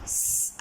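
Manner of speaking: unvoiced स, only the hiss; soft hissed स, no vowel; sound of S. Shown as a demonstration. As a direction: breathe out a sharp स hiss. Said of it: "इन दोनों letters का sound हो जाएगा".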